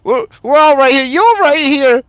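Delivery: very high, loud wail; weeping mother. Acted. Gromma reassures her child she is alive, weeping wail. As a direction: cry out insistently, high and loud. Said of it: "Gromma starts to weep".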